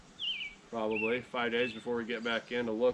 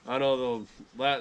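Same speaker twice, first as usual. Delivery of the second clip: usual speaking voice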